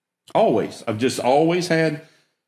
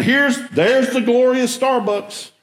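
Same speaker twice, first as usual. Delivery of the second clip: much higher, slower mock joyful reveal; mock delight; 11 semitones higher, slower than usual. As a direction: announce it like a prize he finally found. Mock triumph at finding the store.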